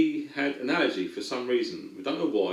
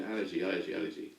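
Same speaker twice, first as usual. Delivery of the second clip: low, soft, repeated thought; thinking to himself; 5 semitones lower, 9 dB quieter, much faster than usual. Retold or imitated. Man puzzling over the boy's allergy, thinking to himself. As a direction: turn a word over under your breath. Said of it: "And I'm thinking".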